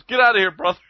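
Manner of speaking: high-pitched imitation of a dismissive shout; mock shooing off. Old guy walking by to the hustler, mock shooing off. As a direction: send him packing, hammed up for the story. Retold man shooing him away.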